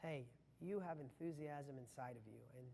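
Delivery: a deliberately boring motivational line; dull, low-energy pitch. Shown as a demonstration. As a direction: say it like you don't care at all. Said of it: "If I talk like that to you".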